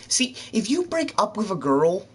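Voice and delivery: usual speaking voice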